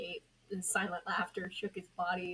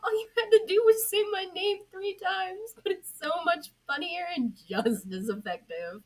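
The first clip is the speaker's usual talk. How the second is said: much higher, louder gleeful spirit voice; cackling spirit; 12 semitones higher, 10 dB louder than usual. Acted. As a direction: cackle through the confession. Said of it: "he cackled".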